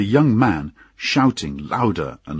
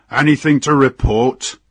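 louder, higher captain's challenge; captain's brusque demand; 6 semitones higher, 6 dB louder than usual. Acted. Captain surprising his sleepy mate on watch, brusque and loud. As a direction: call it out as an officer demanding a report.

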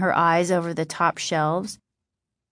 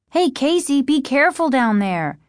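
raised, high warning call; higher, projected call; calling after him; 6 semitones higher, 3 dB louder than usual. A narrator's reading. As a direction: call a caution after someone leaving. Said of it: "she called after him".

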